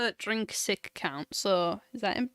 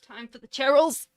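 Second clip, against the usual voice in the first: mock shout; 4 semitones higher, 11 dB louder than usual. Repeating the phrase mockingly.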